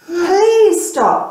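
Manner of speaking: high pleading voice; boy begs the pot, high and pleading; begging. Acted. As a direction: beg the pot to stop. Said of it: "begged the boy".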